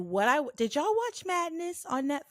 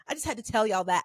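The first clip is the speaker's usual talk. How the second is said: usual speaking voice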